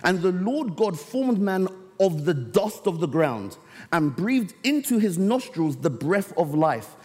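preacher reading the Bible to the church, slow and solemn; scripture reading. Acted. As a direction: lift the text out slowly for the congregation. Reads scripture aloud.

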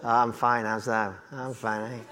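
Yorkshire chat. Retold or imitated. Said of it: "we did used to use these and thous".

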